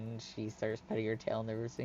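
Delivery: normal talking voice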